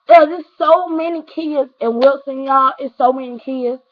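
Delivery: ordinary talk, nothing marked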